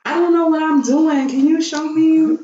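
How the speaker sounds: a put-on helpless girl asking for help; playing dumb. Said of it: "Acting dumb as fuck".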